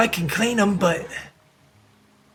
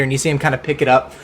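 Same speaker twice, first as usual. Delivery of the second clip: ordinary talk, nothing marked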